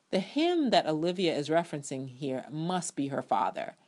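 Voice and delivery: usual speaking voice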